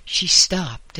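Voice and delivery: usual speaking voice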